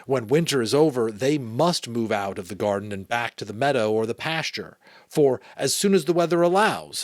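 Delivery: animated read-aloud with lifted phrases; story narration. A narrator's reading. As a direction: read a children's novel aloud with emphasis.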